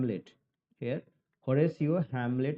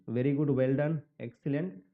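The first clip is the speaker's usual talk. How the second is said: usual speaking voice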